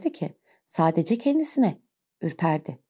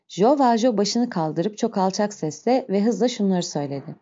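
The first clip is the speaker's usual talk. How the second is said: ordinary delivery, nothing marked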